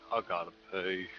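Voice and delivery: low, soft mumbled answer; a touch of a sheepish athlete; mumbled reply. Retold or imitated. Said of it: "he's like".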